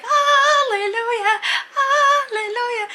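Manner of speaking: very high, slow sung-out praise; joyful hallelujah. Retold or imitated. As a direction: sing it out like church relief. Relief as bully gets punished.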